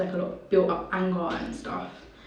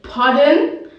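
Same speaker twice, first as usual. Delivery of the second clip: much louder, higher questioning burst; shocked disbelief; 7 semitones higher, 13 dB louder than usual. Retold or imitated. Girl reacting to news of her mother's death, shocked. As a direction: re-enact a stunned, loud demand to hear it repeated.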